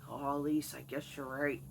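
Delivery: a hint of a defeated father; meek father. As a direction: sheepishly give in as dad. Father voiced in story.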